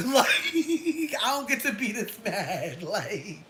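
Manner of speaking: high, laughing through the words; can't stop laughing; real laughter while talking. Real, not acted. Laughing at her own story.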